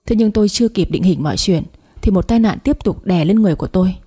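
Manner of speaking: usual speaking voice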